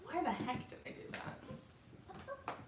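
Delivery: very soft, high, near-whisper; mock whisper of private embarrassment; hushed inner thought. Speaker recounts her thought after winking, hushed high whisper.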